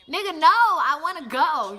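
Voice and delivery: loud, slowed, firm no; loud refusal. Retold or imitated. Telling the guy she wants to leave, loud firm refusal. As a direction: shut him down loudly and slowly.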